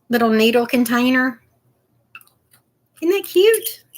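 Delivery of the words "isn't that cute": high, delighted squeal; excited. Real, not acted. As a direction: gush over something cute. Showing off a cute container.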